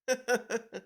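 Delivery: high, amused chuckling; real laugh. Real, not acted. Reader reacts to an absurd electrocution warning, real laugh. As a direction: burst into a run of giggles. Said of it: "I had to laugh at that".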